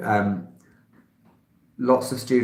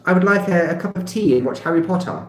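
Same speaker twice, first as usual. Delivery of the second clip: higher-pitched mock Queen's English accent; posh Queen's English; 6 semitones higher than usual. Foreigners' idea of how Brits speak, mock Queen's English. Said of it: "everyone speaks like the Queen".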